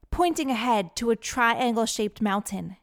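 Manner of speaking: usual speaking voice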